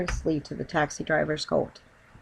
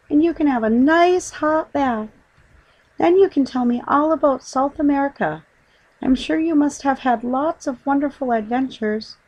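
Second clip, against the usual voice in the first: put-on high, eager girlish voice; little girl chattering happily; young girl; 9 semitones higher, 4 dB louder than usual. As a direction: coax him along like an excited child. Judy voiced in story.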